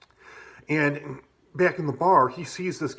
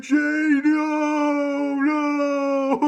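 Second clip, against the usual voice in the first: much higher, louder shrill wail; mock panicked plea; 6 dB louder, much slower than usual. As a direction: ham up the victim being pinned in the snow. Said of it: "he's like".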